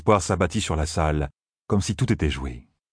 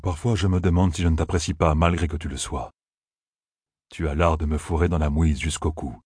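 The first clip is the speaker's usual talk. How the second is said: ordinary delivery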